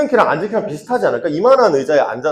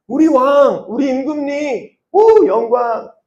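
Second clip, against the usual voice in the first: high, drawn-out acted cheers; adoring acclaim; 9 semitones higher, slower than usual. Crowd hailing its king days earlier, adoring acclaim. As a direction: slowly hail a king in a high voice.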